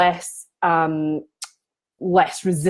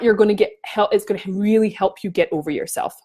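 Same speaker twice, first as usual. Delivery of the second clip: ordinary talk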